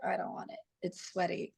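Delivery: son's mumbled refusal. Retold or imitated. Mom imitating her son turning down the sweaty medal, soft mumble. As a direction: mumble it like a sulky boy turning down a gift.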